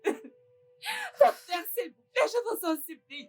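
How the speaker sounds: slow, high wailing cry; wailing sobs. Acted. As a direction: wail to the Lord in despair. Mother despairs after refusal. (la mère désespérée se lamente en gémissant et en pleurant)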